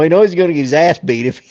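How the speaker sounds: usual speaking voice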